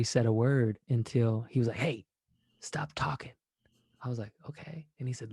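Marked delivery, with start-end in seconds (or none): 1.8-3.3 soft whisper, almost no voice; whispered hush. Retold or imitated. Kali hushing his friend to watch nature, in a whisper. 4.5-4.9 very soft, barely voiced; whispered okay. Agreeing to be quiet in the tent, whispered. Obeying the hush.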